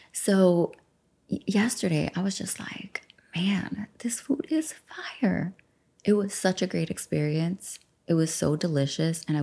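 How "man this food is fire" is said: hushed, breathy whisper, softer, pitch lifting on food; whispered delight. Retold or imitated. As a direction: whisper it like a secret treasure. Savouring food memory.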